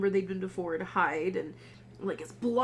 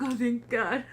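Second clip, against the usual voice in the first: tearful relief; 3 semitones higher than usual. Retold or imitated. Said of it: "But she's like".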